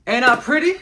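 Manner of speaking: loud, high playful boast; channeled Ali boast. Acted. Medium channeling Ali to host Ashley, loud teasing boast. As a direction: crow it out like a proud champ. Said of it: "I heard "Ain't I Pretty" in my inner space really loudly and vibrantly".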